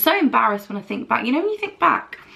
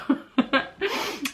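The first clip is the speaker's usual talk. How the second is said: laughing at herself; 5 semitones higher, 5 dB quieter than usual. Real, not acted. Laughing at own story.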